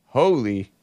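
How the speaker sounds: "holy" with a long, drawn o; final answer, HOH-lee. Shown as a demonstration. Telling viewers the right pronunciation, "holy" as HOH-lee. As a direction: settle on "holy" as HOH-lee, set apart. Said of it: "It's pronounced".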